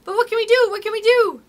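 high, loud, helpless questioning; panicked cry. A narrator's reading. Lost boys facing wolves, panicked cry.

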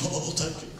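soft, sighing imitation of a smitten wife; dreamy mock wife. Husband mimics wife melting over car smell, soft. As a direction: breathe it like she's swooning.

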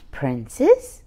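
usual speaking voice

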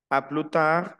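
"A plus tard" as "a plu taar"; French "A plus tard", endings left silent. Shown as a demonstration. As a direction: model the full "A plus tard" without the S. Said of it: "no la menciono".

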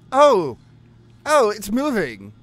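loud, high cry of surprise; startled exclamation. Real, not acted. Player sees a thing start moving, startled exclamation. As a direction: blurt it out high and loud in alarm.

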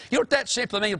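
normal talking voice

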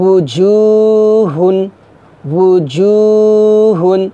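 উজুহুন chanted very slowly, each sound held; drawn-out recitation. Shown as a demonstration. Teacher chanting উজুহুন for students to repeat. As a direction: draw out উজুহুন in a slow Quranic chant. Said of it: "জিমের মাখরাজটাকে জিহ্বার মধ্যখান".

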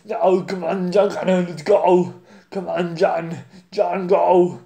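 loud Terminator-style action hero voice; Arnold Schwarzenegger. Acted. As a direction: bark orders like a movie cyborg. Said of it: "so I'll do Arnold first".

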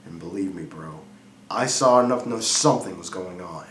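hushed, low, brooding delivery with long pauses; brooding confession. Acted. Jealous husband confiding in Frankie about his wife, hushed and brooding. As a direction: confide it softly and low, letting pauses hang. Brooding opening of dramatic monologue.

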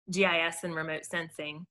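ordinary talk, nothing marked

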